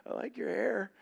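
high, light, faux-innocent tone; teasing the pouting girl; a hint of mock innocence. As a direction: lightly play it off. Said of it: "I'm like".

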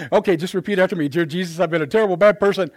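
lightly acted official reciting a script; feed the prayer line by line, mockingly brisk; altar prayer leader. Church leader Mirandizing someone at the altar, mock recitation. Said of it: "they Mirandize you when you get to".

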